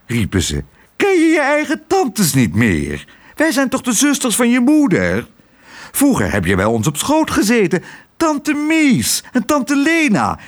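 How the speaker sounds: high, gushing voice of fussy aunts. Acted. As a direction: smother him with reproachful delight. The aunts fuss over Tos.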